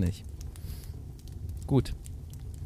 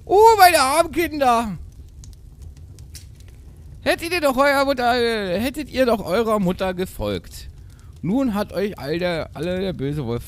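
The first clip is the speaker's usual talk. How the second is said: mock sobbing mother; 13 semitones higher, 4 dB louder than usual. A narrator's reading. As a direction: lament loudly like a hammed-up goat mother. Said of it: "Sie schluchzte und weinte". (übertrieben klagende Geißenmutter)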